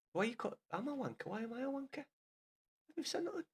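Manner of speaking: high, soft piss-take of a viewer; mocking whiny commenter. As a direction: squeak it like a petulant troll.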